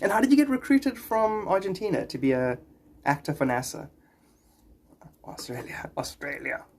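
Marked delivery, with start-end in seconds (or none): 5.2-6.6 whispered correction. Real, not acted. Streamer correcting a viewer's guess, whispered. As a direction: whisper the word Australia.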